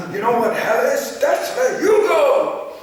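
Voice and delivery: high, loud, slow hellfire preaching; German hellfire preacher. Retold or imitated. Retelling the German preaching of those days, loud, slow hellfire preaching. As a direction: thunder it like a fire-and-brimstone preacher. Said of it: "That was the German preaching those days".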